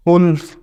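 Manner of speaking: वुल्फ said with a short oo as in book, falling; teacher repeats वुल्फ with the short u of बुक; wolf demo. Shown as a demonstration. Teacher repeating wolf for students, short oo. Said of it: "बुक वाली sound की वुल्फ".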